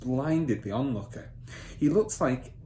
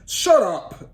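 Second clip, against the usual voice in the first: loud, higher bark; angry shut up; 11 semitones higher, 12 dB louder than usual. Acted. Father silencing Matilda, loud and angry. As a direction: slam it down on her.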